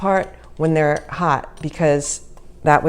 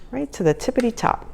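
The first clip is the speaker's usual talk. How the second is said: tippity top lilted higher with a rise; childish sing-song; 7 semitones higher, 4 dB quieter than usual. Shown as a demonstration. Cook repeating her sisters' childhood phrase, softer sing-song. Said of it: "That's what me and my sisters used to say".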